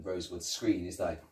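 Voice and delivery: ordinary talk, nothing marked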